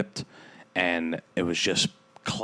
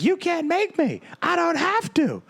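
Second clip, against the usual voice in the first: very high, shrill defiant mock whine; drunk woman's retort. Imitating the drunk woman shouting back at the neighbors. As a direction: fire it back like a drunk teenager. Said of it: "And she's like".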